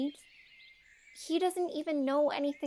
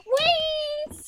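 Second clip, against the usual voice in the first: high, loud, drawn-out shout; urgent high-pitched call; calling after someone; 13 semitones higher, 5 dB louder than usual. Acted. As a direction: shout to stop someone who is leaving. Calls after departing prince.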